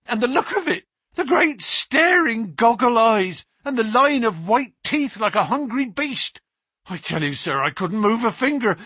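the policeman reliving the face at the window; terrified constable. Acted. Constable Walters telling Baines and Holmes of the beast, high and terrified.

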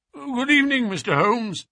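high-pitched, edgy greeting; jangled, nervous man. A narrator's reading. Nervous patient greets the detective on the stair, high jittery voice. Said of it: "his voice testified to his jangled".

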